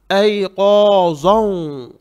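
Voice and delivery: ऐ क़ाज़न slow and loud, rounded qo-zon; heavy za. Shown as a demonstration. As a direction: say ऐ क़ाज़न with thick, drawn-out vowels. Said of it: "ज़ा भी मोटा पढ़ेंगे".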